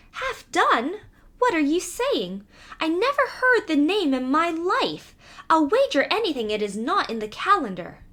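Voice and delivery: a mouse who can't believe it; astonished mouse. Acted. Mouse doubting the cat's name, astonished high voice.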